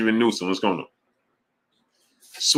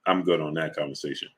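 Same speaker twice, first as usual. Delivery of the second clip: much lower and softer, giving up; resigned; 9 semitones lower, 9 dB quieter than usual. Real, not acted. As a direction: drop it, defeated. Gives up on argument.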